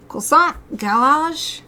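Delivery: ordinary talk, nothing marked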